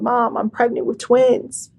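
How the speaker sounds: ordinary talk, nothing marked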